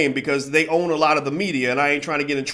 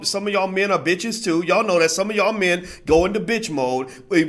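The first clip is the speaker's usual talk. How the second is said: ordinary talk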